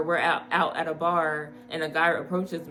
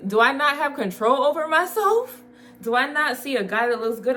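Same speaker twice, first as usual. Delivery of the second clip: indignant; 7 semitones higher, 4 dB louder than usual. Real, not acted. Indignant rhetorical questions.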